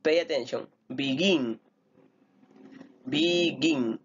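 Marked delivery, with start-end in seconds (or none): 0.9-1.6 "Begin" with the weight on GIN; stress on GIN. Shown as a demonstration. Teacher asking students to pay attention, stress on GIN in "Begin". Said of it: "Tiene la mayor fuerza de voz en la segunda". 3.1-4.0 "Begin" split in two, weight on GIN; stress on GIN. Shown as a demonstration. Teacher showing the stressed syllable, "Begin" stressed on GIN. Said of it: "Tiene la mayor fuerza de voz en la segunda".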